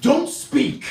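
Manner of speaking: mock French baller laying down the rule; French accent. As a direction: bark the rule like a French player, lightly comic. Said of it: "what he ended up saying was".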